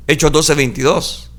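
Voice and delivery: normal talking voice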